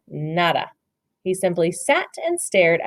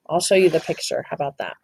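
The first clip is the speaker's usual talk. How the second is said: ordinary delivery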